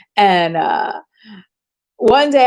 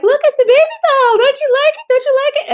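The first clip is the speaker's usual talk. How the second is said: much higher and louder, coaxing family voice; hammed-up relatives coaxing a child over a doll; mock sugary relatives; 4 dB louder than usual. Relatives at Christmas coaxing her to love the doll, high sing-song. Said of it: "they were all looking at me like".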